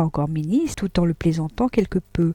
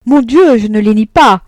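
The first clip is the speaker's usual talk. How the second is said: exasperated; 4 semitones higher, 10 dB louder than usual. Acted. Frédéric answering heatedly.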